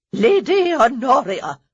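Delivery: put-on loud, slow old dowager bark; barked call. A narrator's reading. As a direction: bark her name across the room. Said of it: "Lady Danbury barked".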